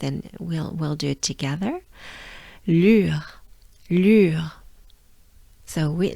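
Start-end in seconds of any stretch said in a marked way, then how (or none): 2.7-4.5 "Lure" loud, long French u, falling onto a French R; sustained U. Shown as a demonstration. Teacher does it once again for learners, sustained U. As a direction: stretch the u in "lure" before the R. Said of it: "Sustain the U a little bit".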